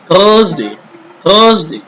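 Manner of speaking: Thursday said thuhs-day, no r; silent r. Shown as a demonstration. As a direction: model Thursday with a long vowel and silent r. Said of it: "R should be silent". (Thursday में R silent, 'थसडे')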